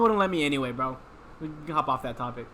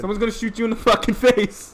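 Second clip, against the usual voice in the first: loud; 6 semitones higher, 7 dB louder than usual. Real, not acted. Heated argument about taking bullet.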